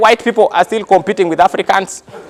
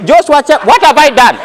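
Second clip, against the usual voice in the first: higher, alarmed mock panic; guilty runner; 6 semitones higher, 3 dB louder than usual. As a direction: plead it nervously. Frightened Ugandan runner.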